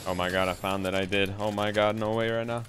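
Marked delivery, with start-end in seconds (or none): none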